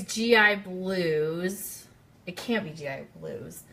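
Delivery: usual speaking voice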